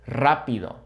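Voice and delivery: "Rápido" starting with a trill; rolled initial R. Shown as a demonstration. Showing learners the easier way, trilled "Rápido". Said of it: "of rolling, right".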